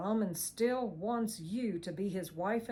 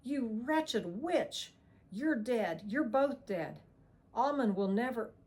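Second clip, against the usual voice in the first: slow, louder, higher rage; furious snarl; 5 semitones higher, 5 dB louder than usual. A narrator's reading. Villain cursing Ava and Johnny, furious. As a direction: spit out curses in fury.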